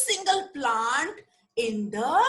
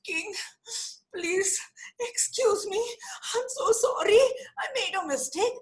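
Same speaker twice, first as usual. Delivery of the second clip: higher, tearful apology; pleading Viru; 8 semitones higher than usual. Acted. Viru apologises to the angry king, tearful. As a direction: beg the king for forgiveness through tears.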